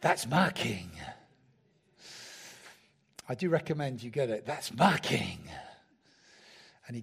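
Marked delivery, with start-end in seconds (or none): 0.0-0.9 low, preacher-style American accent imitation; dodgy American accent. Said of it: "slightly dodgy American accent". 4.4-5.4 low, preacher-style American accent imitation; dodgy American accent. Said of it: "slightly dodgy American accent".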